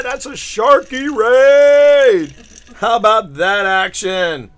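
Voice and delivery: loud, slow, drawn-out hype; hyped announcement. Real, not acted. Streamer hyping the incoming raid to chat, slow and loud. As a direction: announce it like a big event.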